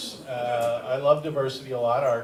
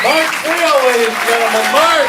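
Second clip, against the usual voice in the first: much higher and louder, like an MC; announcer call-out; 8 semitones higher, 7 dB louder than usual. Real, not acted. Host introducing the comic to the crowd: loud MC call-out.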